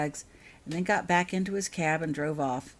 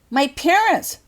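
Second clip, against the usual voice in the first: sudden loud, high outburst; exploding; 10 semitones higher, 12 dB louder, slower than usual. Acted. Dudley breaks the car's silence in panic, loud outburst. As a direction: blurt it out in alarm. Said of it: "exploded Dudley suddenly".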